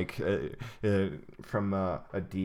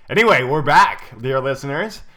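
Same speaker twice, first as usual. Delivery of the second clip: excited; 5 semitones higher, 11 dB louder than usual. Real, not acted. Welcoming listeners back to show.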